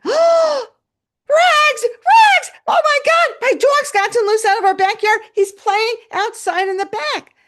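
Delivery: very high, loud gasping cries; shouted, high-pitched alarm; panicked excited boy. Retold or imitated. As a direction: imitate a child shrieking at the sight of his dog. Boy spots his loose dog.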